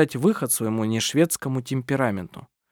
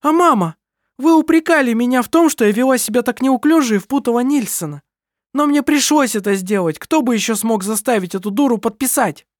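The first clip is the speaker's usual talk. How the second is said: heated argument; 11 semitones higher, 4 dB louder than usual. A narrator's reading. Kerstin argues with mother.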